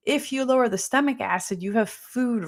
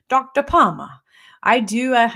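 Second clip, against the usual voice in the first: attempted Jamaican accent; 3 semitones higher, 6 dB louder than usual. Quoting her hilarious Jamaican patient, attempted Jamaican accent. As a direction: have a shaky-handed go at a Jamaican accent. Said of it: "I can't do a good Jamaican accent".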